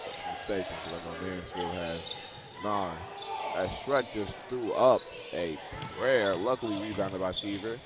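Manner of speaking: brisk basketball commentary; live play-by-play. Acted. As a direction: call the action as it happens.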